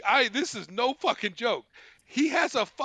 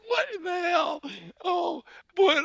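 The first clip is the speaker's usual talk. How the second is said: high, laughed-out bafflement; laughing disbelief; 11 semitones higher than usual. Real, not acted. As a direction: squeeze it out high after laughing.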